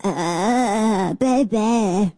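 mock pop-star vocal fry. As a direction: creak it out in exaggerated fry. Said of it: "[name] vocal fry".

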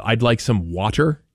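"water" with an American R at the end; American pronunciation. Retold or imitated. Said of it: "some water instead of water".

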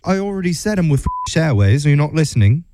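hammed-up British customer-care agent; bad British accent. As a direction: put on a terrible British accent. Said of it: "do my absolute worst British accent".

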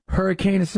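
Hurricane trailing into sibilant gibberish; mock stumbling. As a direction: stumble over the name in a hiss of nonsense syllables. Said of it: "watched people try to say the name".

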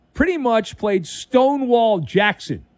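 ordinary delivery

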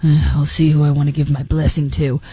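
low-voiced mob boss impression; the Godfather. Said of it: "like he's the Godfather".

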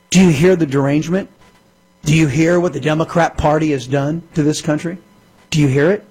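ordinary talk, nothing marked

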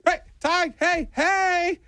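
high, eager repeated hey; calling out. Retold or imitated. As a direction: try to catch his eye through the glass. Calling to a coworker behind glass.

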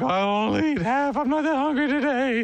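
put-on high, singsong child voice; whining kid bargaining. Kid telling mom about dinner, high mock whine. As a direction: plead like a kid dodging dinner.

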